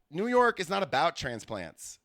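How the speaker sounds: ordinary talk, nothing marked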